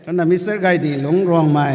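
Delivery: formal roll-call announcement; calling out names. Real, not acted. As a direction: call the next name out clearly.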